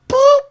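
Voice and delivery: very high, loud squeaky boop; playful boop. Retold or imitated. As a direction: poke sound in a tiny cartoon squeak. Pokes Corey Feldman's arm.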